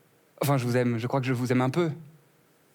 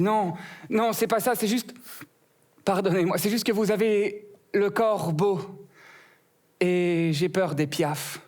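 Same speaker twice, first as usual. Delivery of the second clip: higher, lighter put-on voice for the flower; rose's voice; 6 semitones higher, slower than usual. The rose apologizes to the comic in a higher voice. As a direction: apologize sweetly as a shy rose, slowing down.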